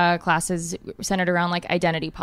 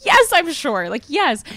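insistent; 8 semitones higher, 6 dB louder, slower than usual. Retold or imitated. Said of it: "I was like".

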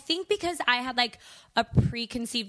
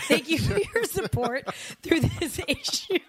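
higher voice breaking into laughter; amused, laugh-filled delivery; laughing thanks; 7 semitones higher, 3 dB louder than usual. Real, not acted. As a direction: say thanks through real giggles. Laughing at being teased.

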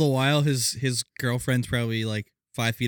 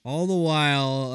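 ordinary talk

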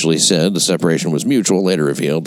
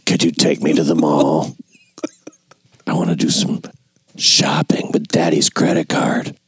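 put-on spoiled girl voice; 2 semitones lower than usual. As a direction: ask like a pampered teen. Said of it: "she sounded like".

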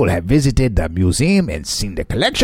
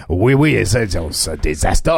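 mock French mayor; 6 semitones lower than usual. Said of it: "That was a direct quote".

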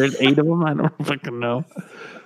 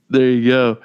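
ordinary talk, nothing marked

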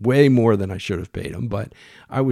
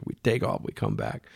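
ordinary delivery, nothing marked

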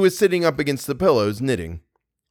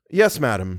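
ordinary delivery

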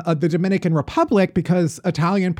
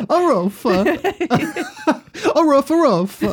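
high mock barks through giggles; Italian dog bark; 11 semitones higher, much slower than usual. Host imitates an Italian dog barking, high silly bark. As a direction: bark the words like an excitable little dog. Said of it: "How do you think an Italian dog barks?".

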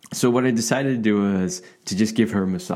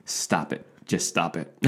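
mock stern command; 7 semitones lower, 7 dB quieter than usual. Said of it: "that's a joke from a comedy sketch".

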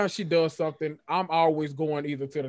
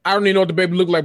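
ordinary delivery

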